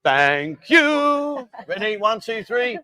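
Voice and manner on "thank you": higher, louder sing-song thanks; sweet, eager little thank you; cute high thank you. Shown as a demonstration. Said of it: "you're gonna do like this, and you're gonna go".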